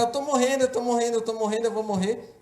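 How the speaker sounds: high, insistent panicked repetition; retold scream. Child believing he is dead after closing his eye, high reenacted cry. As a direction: cry out like a child sure he is dying. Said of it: "eu comecei a gritar".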